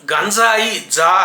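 గంజాయి with dental dz, gan-DZAA-yi; emphatic model గంజాయి with a dz sound. Shown as a demonstration. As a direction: say the జ in గంజాయి as dz, tongue at teeth. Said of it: "దంత్యంతో పలకాలి".

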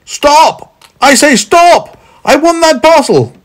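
high, loud yell to halt someone; shouting. A narrator's reading. Man chasing his parcel, shouting. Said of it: "made up for his slowness by his shouting".

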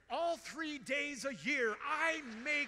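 loud, slow mock-proud shout; shouted comic boast before admitting the joke; joking boast. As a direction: ham it up as if bragging. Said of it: "no, no, kidding, totally kidding".

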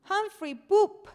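high, loud squealing giggly shout; cheeky toddler squeal; giggling toddler. A narrator's reading. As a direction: shriek it like a little boy who finds poop hilarious. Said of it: "el niño comenzó a reírse".

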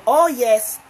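usual speaking voice

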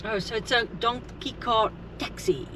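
normal talking voice

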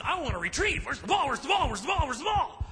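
excited, rising pleading dog voice; frantic mock puppy begging for the ball; eager dog. Preacher voicing eager dog.

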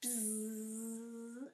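soft buzzing snore; snoring buzz. Acted. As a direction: buzz like a sleeper. Snoring sound.

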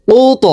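কুত with a sharp upward bounce on the final ত; Qur'anic qalqala on কুত, high and sharp. Shown as a demonstration. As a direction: end কুত with a popping bounced ত. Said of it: "ধাক্কা দিয়ে উচ্চারণ করতে হবে".

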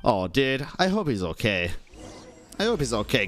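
normal talking voice

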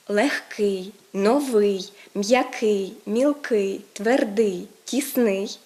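легкий, новий, м'який, each punched on the final syllable; end-stressed adjectives. Shown as a demonstration. Teacher lists model adjectives, stressing every ending. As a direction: list легкий to тісний one by one, hitting each ending. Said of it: "вони мають закінчення наголошене".

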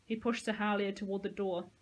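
usual speaking voice

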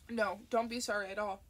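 ordinary delivery

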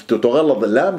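normal talking voice

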